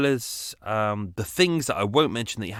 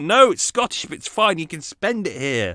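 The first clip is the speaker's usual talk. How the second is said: higher, louder mock protest; exasperated insistence; 8 semitones higher, 7 dB louder than usual. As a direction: imitate pleading with a doubtful shop worker. Retold argument over Scottish note.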